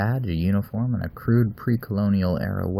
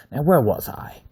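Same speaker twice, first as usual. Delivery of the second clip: slightly exasperated; 7 semitones higher, 5 dB louder than usual. Acted. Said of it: "sounding slightly exasperated".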